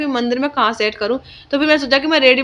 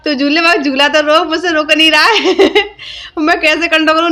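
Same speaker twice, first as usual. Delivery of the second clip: higher, louder, real laughter in the words; laughing while talking; 5 semitones higher, 4 dB louder than usual. Real, not acted. Vlogger recalls the swing that would not stop, laughing. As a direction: break up laughing as you tell it.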